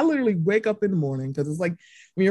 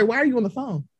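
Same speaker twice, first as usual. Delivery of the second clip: ordinary delivery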